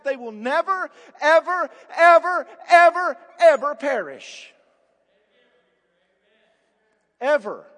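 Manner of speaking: NEVER and every EVER shouted high, one by one; emphatic. Real, not acted. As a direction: pound out each EVER as a separate loud blow. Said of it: "The Greek is emphatic".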